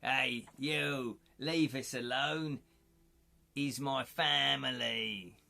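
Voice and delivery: slow, drawn-out London hard man; hammed-up East End tough guy; Ray Winston. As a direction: put on a cockney gangster threat. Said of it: "Ray Winston, Winston".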